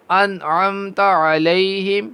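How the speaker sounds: slow, drawn-out melodic tajweed chant; Quran recitation. Shown as a demonstration. Teacher reciting Quran for students. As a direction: recite each verse long and measured.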